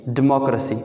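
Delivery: डेमोक्रेसी as "di-MOK-ruh-si"; correct pronunciation. Shown as a demonstration. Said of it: "मॉक, और ये रस्सी है". (सही तलफ़्फ़ुज़, डेमोक्रेसी यानी "डिमॉक्रसी")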